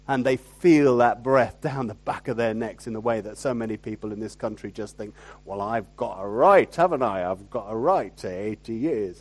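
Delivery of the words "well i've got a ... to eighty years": low Northern English accent, mock complaint. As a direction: put on a lower-pitched Northern English voice. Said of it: "came out in that accent".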